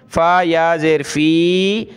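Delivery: फ़ी stretched out on a long ee; long vowel. Shown as a demonstration. Teacher demonstrating the rule just explained, long drawn-out फ़ी. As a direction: hold the ई of फ़ी. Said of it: "कुछ ज़्यादा खींचना होता है".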